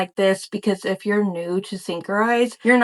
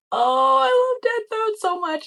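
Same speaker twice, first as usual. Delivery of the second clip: high gushing squeal; fangirl squeal; 13 semitones higher than usual. Real, not acted. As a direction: gush like a fan who just found treasure. Spotting favorite character item.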